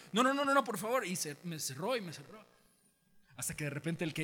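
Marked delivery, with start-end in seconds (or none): 0.1-1.1 rapid-fire high, loud no's; acted frantic refusal. Lady closes the gate on him, loud urgent refusal. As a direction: refuse and shut them out.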